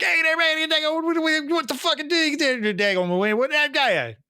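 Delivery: mock a Cajun Wisconsinite rambling at you; Cajun Wisconsin accent. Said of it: "northern Wisconsin southern thing happening".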